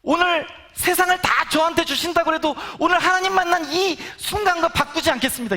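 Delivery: fervent confession. Retold or imitated. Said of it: "이런 고백을 하게 되더라고요".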